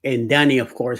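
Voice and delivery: ordinary delivery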